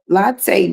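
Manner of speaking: Latte said LAH-tay, open ah; correct Latte. Shown as a demonstration. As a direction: Latte with a full LAH, falling. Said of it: "but no, it's latte".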